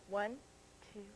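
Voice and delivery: ordinary delivery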